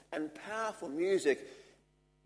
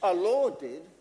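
normal talking voice

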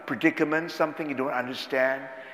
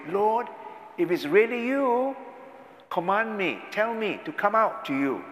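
voice of Peter; 6 semitones higher than usual. Acted. Peter voiced calling to Jesus.

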